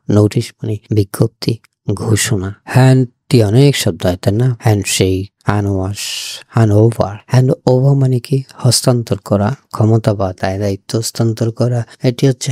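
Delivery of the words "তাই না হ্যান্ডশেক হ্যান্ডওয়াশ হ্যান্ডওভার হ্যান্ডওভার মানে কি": ordinary talk, nothing marked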